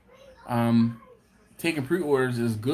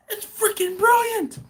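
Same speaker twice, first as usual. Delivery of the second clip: squealing high, loud mock amazement; excited coworker; 6 dB louder than usual. Coworker raving about the cocktail sauce, excited impression. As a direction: imitate an overjoyed coworker, pitched way up. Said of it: "He's like".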